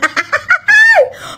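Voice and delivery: real amused laughter. Real, not acted. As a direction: burst out laughing. Laughing at viewer reactions.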